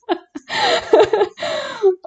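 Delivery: a long high-pitched cackle at the mistake; real giggle at a wrong answer; high amused laugh. Real, not acted.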